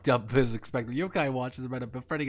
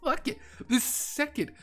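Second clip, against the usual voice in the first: exasperated outburst. Real, not acted. Ranting about alternate universes.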